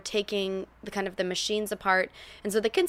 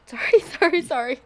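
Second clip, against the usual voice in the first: high, loud laughing apologies; laughing sorry; 7 semitones higher, 6 dB louder than usual. Real, not acted. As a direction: laugh out the sorries. Laughing apology after interrupting.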